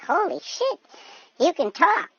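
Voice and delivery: high-pitched amazed disbelief; stunned, squeaky-high exclamation; astonished. Acted. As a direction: act floored, voice leaping up. Man amazed by talking dog.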